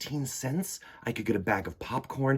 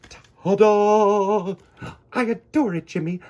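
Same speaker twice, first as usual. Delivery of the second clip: high, loud delighted praise; proud grandmother; 10 semitones higher, 9 dB louder than usual. Acted. Grandmother delighted by Jimmy's art project, high bright praise. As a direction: gush over the child's drawing.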